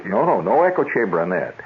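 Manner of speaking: ordinary talk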